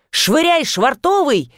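mild high-pitched battle cry; yell. A narrator's reading. Witch throwing the mooring rope, mild high yell. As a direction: call it out as a boat command. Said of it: "С воплем".

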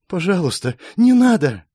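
high, desperate woman's voice; trembling plea. A narrator's reading. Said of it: "Её голос задрожал ещё сильнее". (дрожащая мольба)

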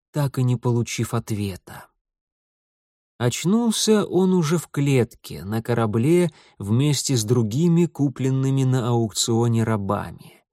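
ordinary delivery, nothing marked

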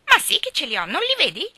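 high, loud mimicking child; mocking echo. A narrator's reading. Geraldine parrots Ivy's scornful phrase, mocking echo. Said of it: "le fece eco Geraldine".

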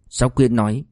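usual speaking voice

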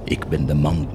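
soft, low whispered boast; dark whisper. Acted. Goetz confiding his blasphemy, whispered. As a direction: hiss the pride quietly.

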